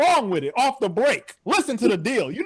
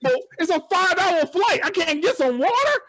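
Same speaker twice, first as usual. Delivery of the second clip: indignant disbelief; 6 semitones higher than usual. Retold or imitated. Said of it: "I was like".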